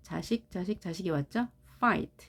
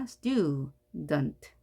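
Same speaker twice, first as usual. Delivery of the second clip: "student" split into stu, dent; stu, then dent, with a gap; syllable by syllable; 3 semitones lower than usual. Shown as a demonstration. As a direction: sound out both halves of "student" separately. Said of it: "물론 여기서는 앞에도 자음이 있습니다".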